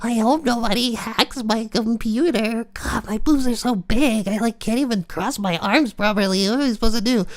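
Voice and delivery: high, breathy-free girly falsetto mockery; hammed-up high girly voice; mock Hilda voice. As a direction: squeak it out like a mocked camgirl.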